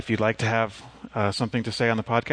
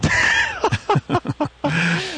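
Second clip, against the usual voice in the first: loud real laugh; 4 dB louder than usual. Real, not acted. Co-host cracks up at the joke.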